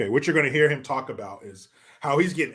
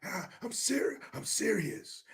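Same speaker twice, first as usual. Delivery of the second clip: softer take on a politician's earnest plea; Newsom; 3 semitones higher, 12 dB quieter than usual. Retold or imitated. Host retrying his politician impression, softer mock-earnest voice. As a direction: drop to a soft, mock-sincere voice. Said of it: "I meant to be talking like Newsom".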